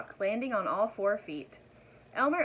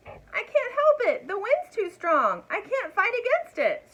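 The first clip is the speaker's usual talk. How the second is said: high, loud, slow desperate scream; 12 semitones higher, 8 dB louder than usual. A narrator's reading. Dragon screaming back to Elmer in the storm, high and desperate. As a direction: scream it, losing the fight with the wind. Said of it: "screamed the dragon".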